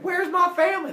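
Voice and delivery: high, loud panicked demand; hammed-up yelling. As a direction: yell it way up high at the monsters. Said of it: "starts yelling at the monsters".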